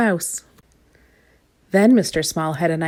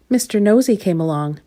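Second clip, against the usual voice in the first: usual speaking voice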